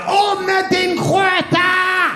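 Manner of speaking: very high, very slow hammed-up foreign yell; mock furious babushka in an unknown language; mock angry foreign shout. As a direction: bellow the gibberish order like an angry babushka. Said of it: "got angry straight away".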